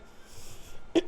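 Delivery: acted "ahem" to interrupt; imitated interrupting cough; pointed throat-clearing. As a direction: clear the throat to announce yourself. Nicole walks in on them.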